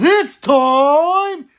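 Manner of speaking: loud, high, drawn-out announcer call; announcer shout. Acted. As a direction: belt it out like a ring announcer. Announcer-style call to subscribe.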